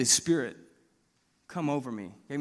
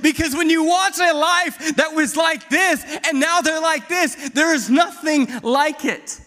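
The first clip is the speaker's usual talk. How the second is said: fiery preaching; 4 semitones higher, 4 dB louder than usual. Acted. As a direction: build the altar call to a shout. Preaching at full force.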